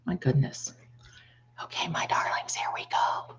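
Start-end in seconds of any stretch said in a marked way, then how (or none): 1.6-3.4 soft unvoiced whisper. Real, not acted. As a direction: hush it to the viewers. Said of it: "I think I'll just whisper".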